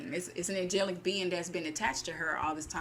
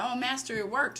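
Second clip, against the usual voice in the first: chuckling servant; 6 semitones higher than usual. Retold or imitated. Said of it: "now he's chuckling and he's like".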